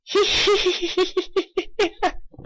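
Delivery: high giggle. Acted. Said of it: "I'm not doing Pinky's laugh".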